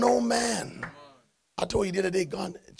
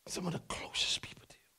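a soft, unvoiced whisper; 12 dB quieter than usual. Real, not acted. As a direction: lean in and whisper it. Dropped to a whisper.

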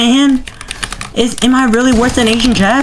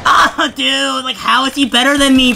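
ordinary talk, nothing marked

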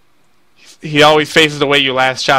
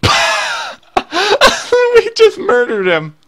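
laughing; much slower than usual. Real, not acted. Killing the boss in one shot.

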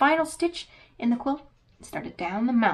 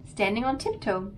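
ordinary delivery, nothing marked

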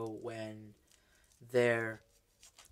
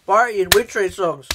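excited exclaim; 7 semitones higher, 12 dB louder than usual. Pretend. Mock outrage about girlfriend.

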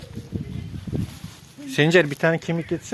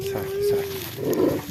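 slow, soft, much higher calming coo; soothing; 5 dB quieter, much slower than usual. Real, not acted. Owner soothing an angry dog, slow soft coo. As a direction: coo it slowly to settle him.

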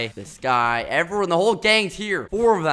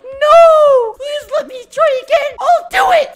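much higher, louder mock begging voice; pleading player; 11 dB louder than usual. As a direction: squeak out a desperate plea. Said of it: "Oh, he's like".

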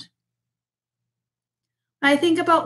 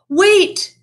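loud, higher call of Wait!; crying out; 7 semitones higher, 7 dB louder than usual. Acted. Bear calling after the departing mouse, loud cry. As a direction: call him back before he leaves. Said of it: "cried Bear".

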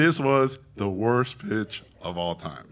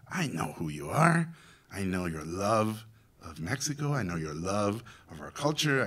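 sincere reassurance; 4 semitones lower, 6 dB quieter than usual. Retold or imitated.

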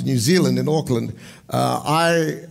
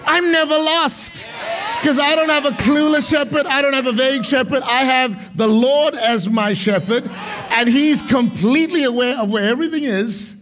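raised, ringing preacher's shout; full-force preaching; 7 semitones higher than usual. Real, not acted.

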